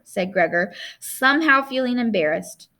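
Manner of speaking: ordinary delivery, nothing marked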